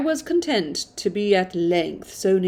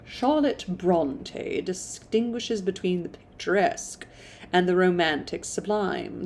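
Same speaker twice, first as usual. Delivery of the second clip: ordinary delivery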